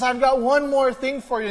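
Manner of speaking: high, loud, excited host announcement; Oprah teasing a surprise. Retold or imitated. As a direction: build the suspense like a talk show host. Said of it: "And all of a sudden she says".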